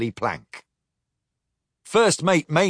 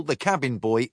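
usual speaking voice

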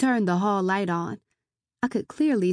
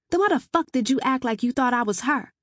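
angry; 5 semitones higher, 3 dB louder than usual. Acted. Heroine snaps at intruder.

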